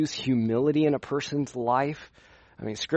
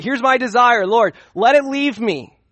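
raised, higher pleading cry to the Lord; pleading prayer; 8 semitones higher, 6 dB louder than usual. Acted. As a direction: beg the Lord, lifting the voice high. Paul's prayer acted out.

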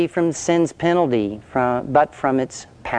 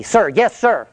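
recruit's reply; 7 semitones higher than usual. Retold or imitated. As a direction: fire it back like a scared recruit. Recruit answers the drill sergeant.

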